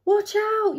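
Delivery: high-pitched storybook warning cry; acted, high warning yell. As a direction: call out in alarm as the hippo. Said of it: "yelled the hippo".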